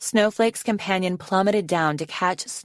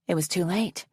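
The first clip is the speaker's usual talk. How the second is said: ordinary delivery, nothing marked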